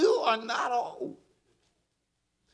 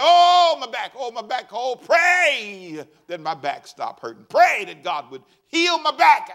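hammed-up groaning about a sore back; moaning caller; 2 semitones higher, 7 dB louder, slower than usual. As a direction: moan it like a whiny caller with back pain. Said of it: "They said".

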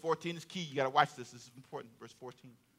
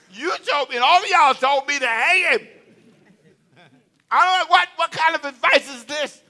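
high, loud complaining husband; indignant Haman; 9 semitones higher, 8 dB louder than usual. Acted. Haman shouting at his wife and friends, indignant. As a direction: throw it back at them in outrage.

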